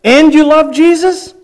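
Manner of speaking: loud, high shouted question; a stranger shouting a question down the hall; yelled question. Retold or imitated. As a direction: call it out for the whole hall to hear. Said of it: "The next statement is".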